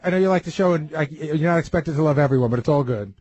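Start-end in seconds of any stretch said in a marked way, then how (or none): none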